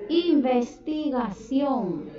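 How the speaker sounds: investigación stretched out slowly, syllable by syllable; slow dictation. Shown as a demonstration. Teacher dictating investigación slowly to pupils. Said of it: "y la, escriban".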